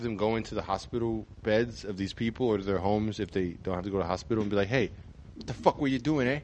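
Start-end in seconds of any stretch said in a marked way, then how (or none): 4.7-6.4 mock New York tough-guy scolding; tough Italian-American guy. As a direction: lean in like a Jersey wiseguy telling him off. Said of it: "an Italian guy or an Irish guy".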